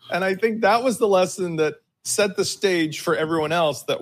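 ordinary talk, nothing marked

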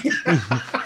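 laugh. Real, not acted. Laughing at own joke.

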